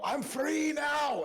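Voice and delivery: high, drawn-out shout of freedom; triumphant yell. Acted. Player's character tearing free of the frog, yelling. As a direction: yell it long, loud and high. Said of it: "I'm gonna yell".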